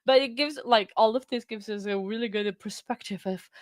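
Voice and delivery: ordinary delivery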